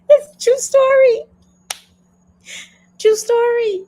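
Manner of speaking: high, loud words through laughter; laughing. Real, not acted. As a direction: gasp it out while still laughing. Still laughing after the story.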